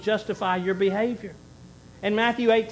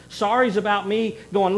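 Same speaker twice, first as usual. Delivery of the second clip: ordinary talk, nothing marked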